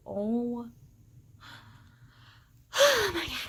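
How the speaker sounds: ordinary talk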